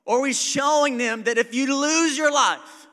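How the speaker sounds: loud, high, driving pulpit cry; full-force sermon climax; fiery preaching. Acted. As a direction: push the point home to the crowd. Preaching crescendo.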